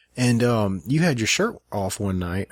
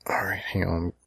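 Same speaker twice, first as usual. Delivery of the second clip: whispering; 3 semitones lower, 6 dB quieter than usual. Real, not acted. Muttering while scrolling.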